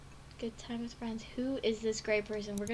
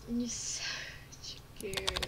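whispered read; 5 semitones lower, 6 dB quieter, much slower than usual. Acted. Reading a game line.

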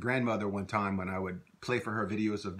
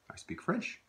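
higher, as a question prompt; 9 semitones higher, 5 dB quieter than usual. Shown as a demonstration. Teacher prompts students' translation, higher. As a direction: lift it like a cue. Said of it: "prompting learner answer".

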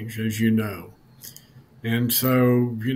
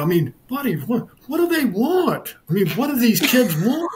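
exasperated outburst; 11 semitones higher, 3 dB louder than usual. Retold or imitated. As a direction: throw up your hands in mock outrage. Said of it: "finally he was like".

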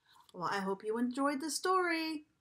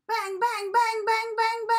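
banging sounds; 10 semitones higher, 7 dB louder than usual. Acted. Reading banging pots sound.

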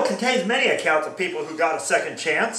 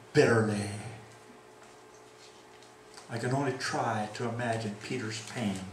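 hushed sorrow; 5 semitones lower, 6 dB quieter than usual. Acted. Preacher telling of Peter weeping, hushed and grave. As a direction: let it sink in quietly.

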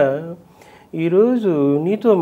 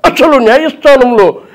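louder courtroom outburst; NTR film courtroom; 8 semitones higher, 5 dB louder than usual. Shown as a demonstration. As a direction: challenge the court like a film hero. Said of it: "ఆయన ఇంకా సినిమాల్లో అయితే".

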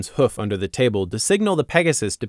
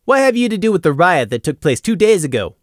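louder, higher hard demand; 7 semitones higher, 4 dB louder than usual. A narrator's reading. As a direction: press him on the riot. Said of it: "Gloom demanded".

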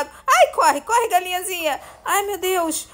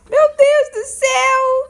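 surprised; 8 semitones higher, 5 dB louder, slower than usual. Real, not acted. Shock at being a chicken.

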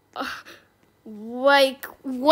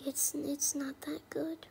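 whispered; 18 dB quieter than usual. Real, not acted. Muttered verdict.

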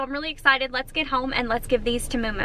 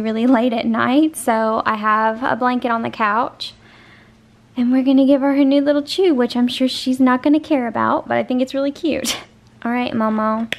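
ordinary talk, nothing marked

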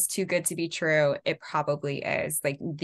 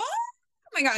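sudden high squeal of surprise; startled cry; slower than usual. Retold or imitated. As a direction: jump an octave in shock. Auditioner startled by the mouse.

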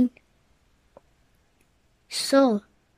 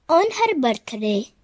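usual speaking voice

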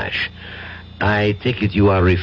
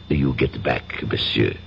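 quiet threat; 8 semitones lower, 5 dB quieter than usual. Acted. Villain warning his captive he may not return, quiet menace. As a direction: lower and softer, menacing.

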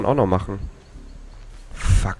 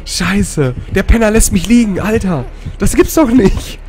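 high, loud exclamations near laughter; incredulous outburst; 10 semitones higher, 4 dB louder, slower than usual. Real, not acted. Player left lying by the killer, loud disbelief. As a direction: curse in amused disbelief.

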